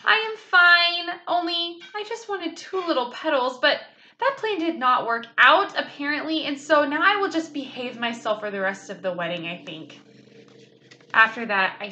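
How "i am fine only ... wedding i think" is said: put-on child talking her way out of trouble; nervous little girl. A narrator's reading. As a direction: explain sheepishly. Nervous child explaining to mother.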